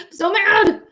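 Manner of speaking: high, loud burst of anger; openly mad. Real, not acted. Streamer irritated by the raids, high angry outburst. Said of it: "I'm so fucking irritated".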